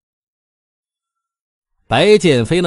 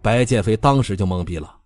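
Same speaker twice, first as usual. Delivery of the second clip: ordinary delivery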